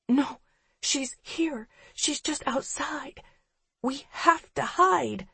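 high, hushed and scared; frightened whisper. A narrator's reading. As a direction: whisper urgently to hide.

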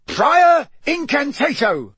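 high, loud, drawn-out roar; roared incantation. A narrator's reading. Mister Diggory casts a spell on the wand, roaring. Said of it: "roared Mister Diggory".

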